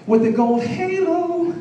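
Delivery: high, slow angelic voice; heavenly halo. Pretend. Comic describing briefs to the crowd, high slow angelic tone. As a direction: sing it out like a choir angel.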